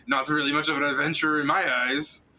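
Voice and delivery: high, scornful taunt; mocking scorn. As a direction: sneer at the so-called adventurer. Mocking the adventurer.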